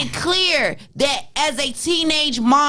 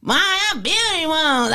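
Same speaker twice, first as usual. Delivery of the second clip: put-on little girl voice; 6 semitones higher than usual. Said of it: "she told her mother".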